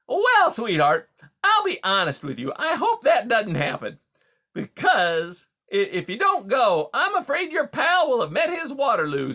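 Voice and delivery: high, loud, glib menace; brash, falsely friendly beaver. Acted. Beaver warns the mouse her pal may suffer, loud and breezily menacing. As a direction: deliver threats as hearty small talk, loud and high.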